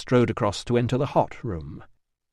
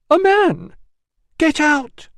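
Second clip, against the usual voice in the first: very high, loud scandalised shriek; outraged bathers screaming; shrieking women; 7 dB louder, slower than usual. Acted. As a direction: scream at the man to leave.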